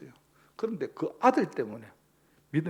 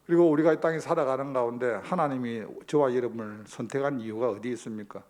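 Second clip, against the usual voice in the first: louder, rising sermon push; full-force preaching; 9 dB louder than usual. Acted. Pastor driving home the sermon point, louder preaching. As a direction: preach it out to the pews.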